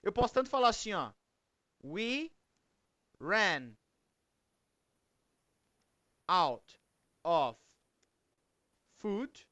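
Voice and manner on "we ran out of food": long pauses after each word; word-by-word example. Shown as a demonstration. Teacher showing the class a phrasal verb, slow and word by word. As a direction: spell out the sentence one isolated word at a time.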